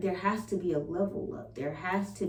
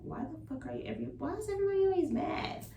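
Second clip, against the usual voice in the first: high-pitched exasperated retort, retold; irritated; 10 semitones higher, faster than usual. Venting about the family always being mad, irritated and high-pitched. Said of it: "I got so irritated".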